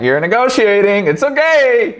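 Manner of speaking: very high, loud coaxing voice; mock soothing. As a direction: talk him down like a child on a playground.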